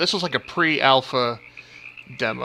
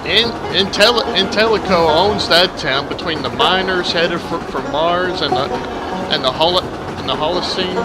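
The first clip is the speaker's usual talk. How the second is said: put-on southern accent. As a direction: lay the Southern accent on thick. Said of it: "exaggerate my already southern accent".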